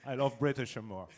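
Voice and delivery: amused, laughing through it; laughing at the British joke. Real, not acted.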